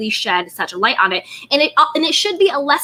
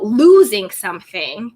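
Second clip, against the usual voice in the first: usual speaking voice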